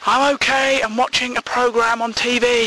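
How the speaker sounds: high-pitched reluctant call back; mock yell from upstairs; shouting down. You shout down to get out of it, high call. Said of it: "You're shouting down".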